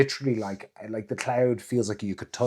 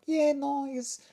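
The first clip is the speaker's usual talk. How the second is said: casual shrug; 13 semitones higher, slower than usual. Retold or imitated. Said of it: "And you're like".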